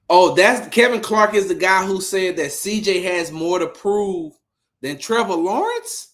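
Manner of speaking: high-pitched disbelief, rising question; incredulous. Real, not acted. Host learns who doubted his quarterback, incredulous. Stunned reaction to analyst's quote.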